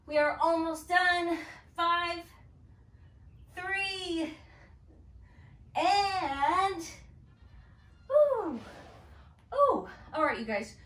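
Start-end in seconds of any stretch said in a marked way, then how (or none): 0.1-6.8 doing sit-ups. Real, not acted. Counting down during Russian twists. 8.1-9.9 loud high strained oohs. Real, not acted. End of hard set.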